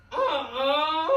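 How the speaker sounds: high fake little sob; hammed-up crying from the sidekick; mock whimper. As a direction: whimper like a big baby. Assistant pretends to cry.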